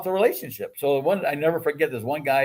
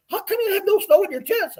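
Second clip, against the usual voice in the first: high, baffled mock voice; puzzled scout leader. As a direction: squeak it like a confused neighbor. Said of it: "The guy looked at me and goes".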